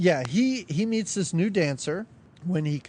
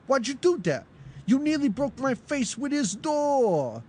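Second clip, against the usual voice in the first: mad; 5 semitones higher than usual. Retold or imitated. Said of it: "he gets so mad".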